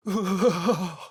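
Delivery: a short high laugh that falls away; dark laugh. Acted. Caster laughing at the spell's power. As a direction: laugh as the spell takes hold.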